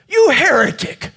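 sudden high furious yell; shouting. Acted. Rescuer suddenly turns on the jumper, high furious shout. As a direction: turn on him with a loud yell. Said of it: "he shouted".

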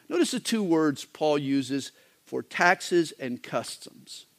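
usual speaking voice

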